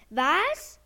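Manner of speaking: high, loud startled cry; shocked. A narrator's reading. Balduin hearing about his withdrawal cure, startled and loud. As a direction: jolt up in disbelief.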